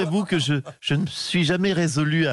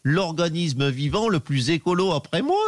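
Ségolène Royal impression; 3 semitones higher than usual. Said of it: "Ségolène Royal".

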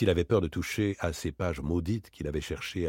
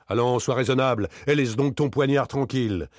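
firm rebuff; 6 semitones higher, 5 dB louder than usual. Acted. Daspry rebuffs the armed man.

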